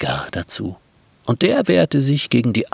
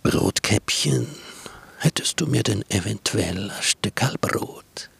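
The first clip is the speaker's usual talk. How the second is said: sly wolf; 2 semitones lower, 4 dB quieter than usual. Acted. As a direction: hiss it like a scheming wolf. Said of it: "scheel, diebisch und hinterlistig, wölfisch".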